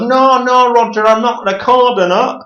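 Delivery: high-pitched mock northern English accent; weird northern accent. Caller denying to Roger he's recording, mock northern accent. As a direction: imitate an odd northerner, voice pushed right up. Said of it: "this really fucking weird northern accent".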